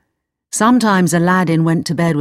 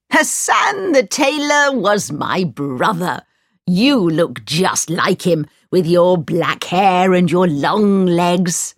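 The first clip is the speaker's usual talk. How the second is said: put-on slow, emphatic old man voice, often leaping high; effusive old man; 3 semitones higher than usual. Old man claims Aladdin as his nephew, effusive old man. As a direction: gush slowly like a long-lost uncle.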